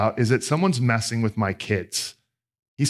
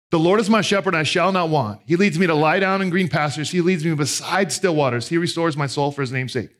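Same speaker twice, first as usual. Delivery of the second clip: scripture reading; 4 semitones higher than usual. Acted. Reading Psalm 23 aloud.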